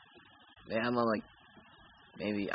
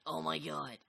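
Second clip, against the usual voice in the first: put-on dude voice; 6 semitones higher, slower than usual. Dude character rehearsing his line, mock surfer voice. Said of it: "It's like".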